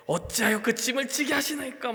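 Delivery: mock-exasperated plea, stress on 짐을; exasperated complaint. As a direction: complain like a worn-out leader. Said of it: "막 이러는 거예요".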